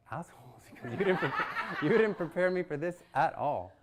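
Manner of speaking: mock scolding his friends; playful outrage through laughter; laughing mock complaint.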